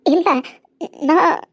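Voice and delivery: happy tears. Acted. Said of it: "நான் அழுவல".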